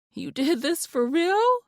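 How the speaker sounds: high, awed question; amazed. A narrator's reading. Wife asking husband in awe, amazed. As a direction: ask it in disbelief.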